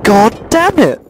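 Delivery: loud high frustrated outburst; frustrated yell. Real, not acted. Gamer cursing after dying seconds in, frustrated yell. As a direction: curse at the game at full voice.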